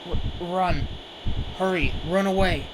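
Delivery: shouting in alarm as the monster breaks loose; panicked warning. Acted. Monster attacks, warns friend to flee.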